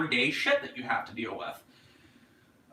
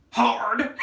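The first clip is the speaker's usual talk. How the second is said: laughing; 10 semitones higher, 5 dB louder than usual. Real, not acted. Joke then laugh.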